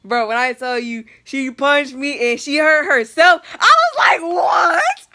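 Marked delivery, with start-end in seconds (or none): 1.2-5.1 high, loud, squealing disbelief; incredulous outburst. Real, not acted. Telling how mom punched her, shrieking disbelief. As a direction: shout it high, breaking into laughter.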